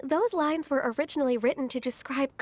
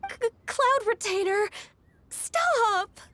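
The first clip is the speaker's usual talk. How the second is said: high, mortified yelp to cut her off; flustered protest; 8 semitones higher, slower than usual. Acted. Disciple embarrassed by her master's childhood story, flustered high protest. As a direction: stammer it out, embarrassed and pleading.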